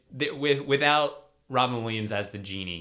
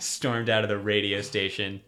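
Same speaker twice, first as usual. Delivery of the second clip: normal talking voice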